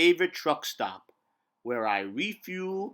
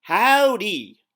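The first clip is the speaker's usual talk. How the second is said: cheery howdy; 5 semitones higher, 8 dB louder than usual. Acted. Truck narrator greets listeners.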